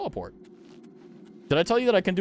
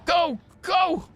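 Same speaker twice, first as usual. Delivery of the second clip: excited cheering. Real, not acted. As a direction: cheer the hero on. Cheering on in game.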